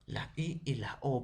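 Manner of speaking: usual speaking voice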